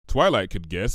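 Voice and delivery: plain reading voice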